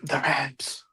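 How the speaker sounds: whispering on the phone. Acted. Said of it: "Why are y'all whispering?".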